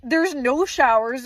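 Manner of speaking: ordinary talk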